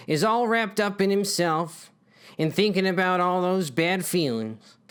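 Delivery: normal talking voice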